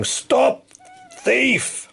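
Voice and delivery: high shouted stop thief; shouting. Acted. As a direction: yell after the thief. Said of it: "waving a rake and shouting".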